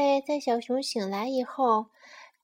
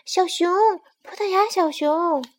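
raised, higher calling voice; sparrow calling out; 6 semitones higher, 8 dB louder than usual. Acted. Sparrow calls after the running bear, shouting. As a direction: call loudly to get a friend's attention. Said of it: "麻雀喊道".

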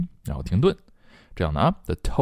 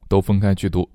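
ordinary delivery

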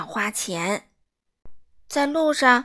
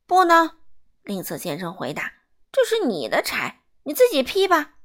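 curt loud refusal; 4 semitones higher, 4 dB louder than usual. Acted. Miser refusing the old man.

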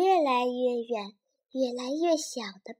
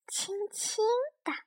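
whispering; 9 semitones higher, 7 dB quieter than usual. A narrator's reading. Narrator describes gentle covering.

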